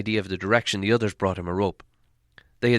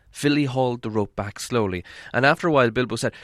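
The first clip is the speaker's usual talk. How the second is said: plain reading voice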